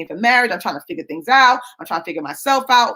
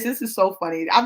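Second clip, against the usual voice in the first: normal talking voice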